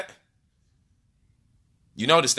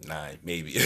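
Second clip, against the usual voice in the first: ordinary delivery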